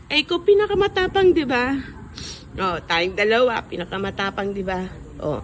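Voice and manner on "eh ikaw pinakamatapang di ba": much higher and fast, hyping her up; cheering praise. Real, not acted. Child praises bedridden mother's courage, high fast cheer. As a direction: praise her bravery with a lift.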